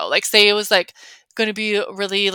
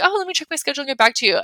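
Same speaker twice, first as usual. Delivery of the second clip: quick brush-off; 5 semitones higher, much faster than usual. Shown as a demonstration. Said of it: "So I'll just be like".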